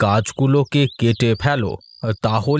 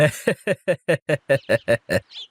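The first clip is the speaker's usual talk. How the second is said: sneering laugh. Acted. Villain mocks the teacher's pupils.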